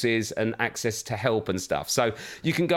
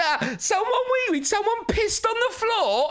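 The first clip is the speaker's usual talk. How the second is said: put-on high squeaky child voice; imitating daughter. Father voices daughter gleefully explaining, high squeaky child voice. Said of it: "She went".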